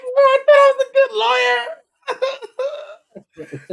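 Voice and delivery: very high, wailing fake sob; high hammed-up sob about being a bad lawyer; mock crying. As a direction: whine it like a crybaby.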